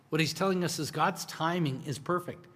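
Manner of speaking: ordinary delivery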